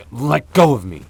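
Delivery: loud, high demand to be released; struggling shout. Acted. Vampire shouting at the hunter holding her down. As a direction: shout while fighting the grip.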